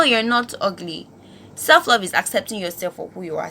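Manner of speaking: ordinary talk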